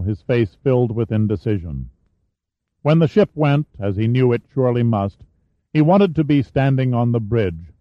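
plain reading voice

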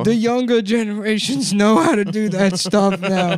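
high, slow, sing-song mockery; high mocking voice. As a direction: talk down like a sweet helper. Mocking voice answering cashier.

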